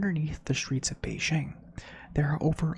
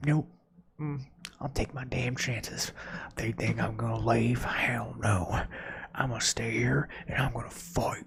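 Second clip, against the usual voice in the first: mock Connecticut accent; 2 semitones lower than usual. Resident refusing to evacuate, in a mock Connecticut accent. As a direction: play a stubborn local in a made-up Connecticut accent. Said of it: "I'm using that accent for Connecticut".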